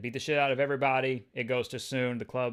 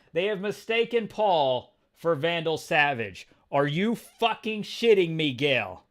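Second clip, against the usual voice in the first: louder, higher disbelief; incredulous outburst; 5 semitones higher, 7 dB louder than usual. Real, not acted. Reader learning Paul is mistaken for Savage, loudly incredulous. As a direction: hit it with real incredulity. Reacts to the plot twist.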